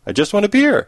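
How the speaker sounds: high-pitched, insistent dog's plea; mock-desperate plea. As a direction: beg for it, pitch jumping up. Dog repeats plea more urgently.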